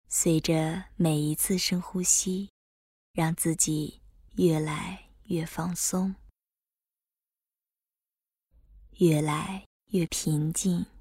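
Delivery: calm guide easing the body; guided meditation. Acted. Leads breath focus meditation.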